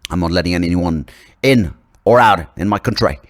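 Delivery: put-on loud, emphatic Aussie accent going astray; weird-sounding Australian accent. Australian leader guarding the border, oddly slipping Aussie accent. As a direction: lay down the law in wobbly Australian. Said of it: "suddenly it sounded weird there".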